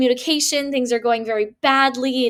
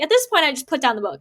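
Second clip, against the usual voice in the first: normal talking voice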